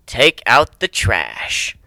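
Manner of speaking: ordinary delivery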